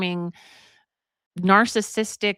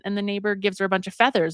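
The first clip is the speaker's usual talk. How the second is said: usual speaking voice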